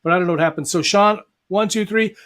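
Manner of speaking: ordinary delivery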